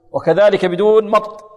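normal talking voice

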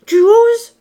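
very high, very loud outburst; outraged. A narrator's reading. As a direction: explode in scandalized disbelief. Mother outraged at her son. (cri outré et suraigu)

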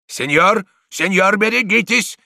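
high, loud, urgent cry; shouted warning. A narrator's reading. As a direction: warn someone across the fight. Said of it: "кричал Дон Иниго". (предостерегающий крик)

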